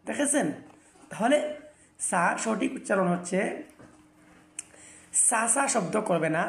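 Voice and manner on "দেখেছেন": ordinary delivery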